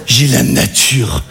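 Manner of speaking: very slow, low deadpan repeat; dragged, low mocking echo; appalled echo. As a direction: stretch the words out in disbelief. Son echoes the excuse, appalled.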